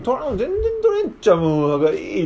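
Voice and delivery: high, fast, fed-up whine; grumbling fishermen. Acted. Disciples answer the stranger, high-pitched and exasperated. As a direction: whine like worn-out fishermen who caught nothing. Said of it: "って言って".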